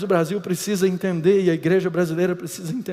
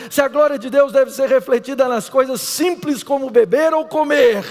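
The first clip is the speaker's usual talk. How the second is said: raised, higher preaching voice; 6 semitones higher, 6 dB louder than usual. Acted. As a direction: proclaim it to the congregation. Pregação em alta voz. (pregação fervorosa, voz mais alta)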